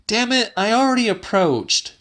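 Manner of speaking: high and fast, a touch exasperated; Celia, irritated. A narrator's reading. Woman fed up with the old man's commands, fast and high.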